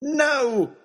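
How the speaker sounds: high desperate shout. Acted. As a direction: yell no, too late. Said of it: "I shouted".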